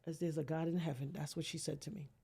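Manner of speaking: ordinary talk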